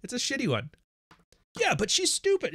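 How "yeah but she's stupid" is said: much higher whiny mock voice; high mocking retort; mock objector. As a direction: squeak it out like a dismissive critic.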